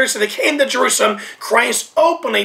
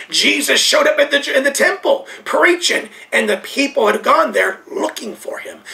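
ordinary talk, nothing marked

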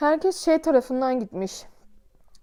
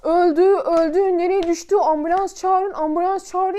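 loud frantic yelling, higher; alarmed shouting; 5 semitones higher, 5 dB louder than usual. Retold or imitated. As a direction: cry out for an ambulance. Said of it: "Abiler de böyle".

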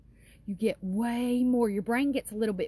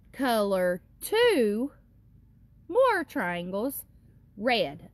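slow, drawn-out worksheet reading; slow reading aloud; 2 semitones lower, slower than usual. Acted. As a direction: read each word slowly for little kids.

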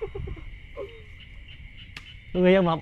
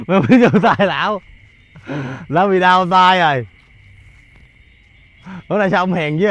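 normal talking voice

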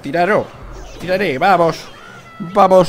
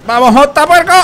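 high, loud rallying call; shouted encouragement; excited cheer; 11 semitones higher, 9 dB louder than usual. Real, not acted. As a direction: egg on the hog rider. Cheering his hog rider.

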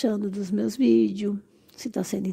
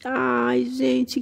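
normal talking voice

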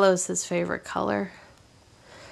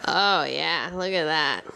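usual speaking voice

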